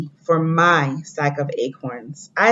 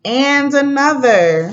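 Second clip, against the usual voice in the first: higher, louder, drawn-out "and another"; dramatic reveal; 8 semitones higher, 4 dB louder, slower than usual. Acted. Teacher revealing the next city to the class, dramatic and loud. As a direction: build suspense on the page turn.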